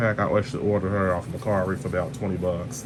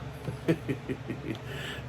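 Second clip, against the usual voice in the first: breathy laugh; 4 semitones higher, 10 dB quieter than usual. Real, not acted. Laugh after impression.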